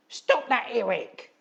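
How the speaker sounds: high, loud holler. Acted. Edith hollering at Eric, a high loud shout. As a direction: bark it at the crying son. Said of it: "hollered his unsympathetic mother".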